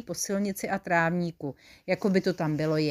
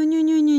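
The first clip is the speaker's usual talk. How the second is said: put-on higher and louder baby-talk coo; 8 semitones higher, 6 dB louder than usual. A narrator's reading. Whole army melts over the sheep, cooing. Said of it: "dělala ňu".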